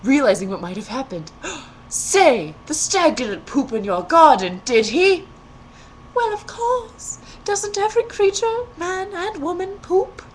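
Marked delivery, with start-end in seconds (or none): 1.3-1.8 gasp. A narrator's reading. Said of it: "The prince gasps". 1.9-5.3 loud, urgent prince voice; alarmed prince. A narrator's reading. 6.2-10.2 put-on much higher naive girl's voice; innocent girl. A narrator's reading.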